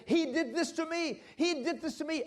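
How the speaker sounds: high, loud furious cry; loud, high mock scream of a hurt wife; imitating a scream. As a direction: throw it out like a betrayed wife screaming. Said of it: "She just screamed at me".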